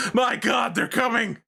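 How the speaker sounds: high-pitched panicked cry of alarm; mock terrified scream. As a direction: cry out in hammed-up terror, voice leaping up. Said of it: "people don't come out screaming".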